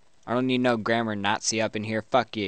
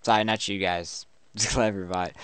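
usual speaking voice

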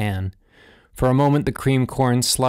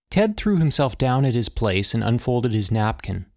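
plain reading voice

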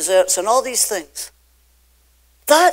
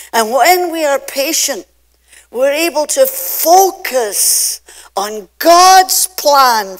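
slow, louder preaching; 3 semitones higher, 6 dB louder than usual. Acted. Pastor exhorting the congregation with slow loud preaching. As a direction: drive the point home from the pulpit.

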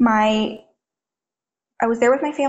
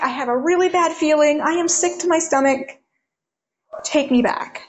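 higher, uneasy imitation of a sick-feeling kid; anxious pleading; 6 semitones higher than usual. Little brother on the phone, higher anxious voice. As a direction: voice him high and worried. Brother phoning, begging to return.

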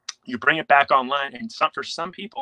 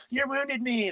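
put-on much higher, whiny hurt-child voice; childish five-year-old; 11 semitones higher, 4 dB quieter, slower than usual. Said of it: "you're acting like a five-year-old".

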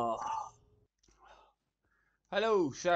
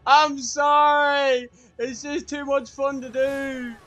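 higher and louder, through giggles, slow on sorry; laughing fake apology; 10 semitones higher, 10 dB louder than usual. Real, not acted. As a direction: apologise while you can't stop laughing.

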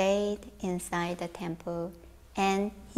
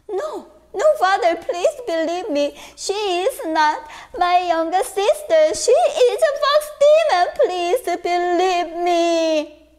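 high, loud frantic begging; desperate pleading; 9 semitones higher, 7 dB louder than usual. Acted. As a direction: beg him to believe you. Son begging father.

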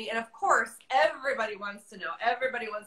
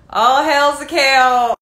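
shout; 12 dB louder, slower than usual. Acted. Announcing the guest's entrance.

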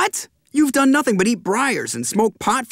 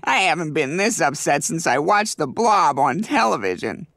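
usual speaking voice

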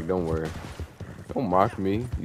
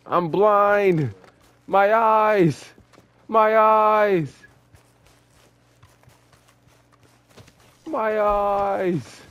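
mock agony; 7 semitones higher, much slower than usual. Blinded by fog, dramatic.

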